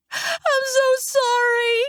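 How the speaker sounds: slow, very high wail; drawn-out, high tearful cry; anguished apology. A narrator's reading. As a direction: wail the apology through pain. Water breaks on dying brother.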